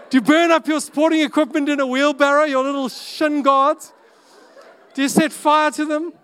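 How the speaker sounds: high, loud, half-laughing question; incredulous shout. Real, not acted. Preacher shouting the absurd question to the congregation, laughing. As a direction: shout it up in amused disbelief.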